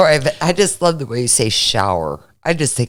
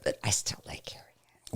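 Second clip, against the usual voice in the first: soft breathy whisper; 3 semitones lower, 17 dB quieter, faster than usual. Real, not acted. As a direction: whisper it like a secret. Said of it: "whispered aside".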